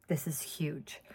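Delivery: breathless whispered aside; mock-solemn whisper. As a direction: whisper it like a secret announcement. Mock formal announcement.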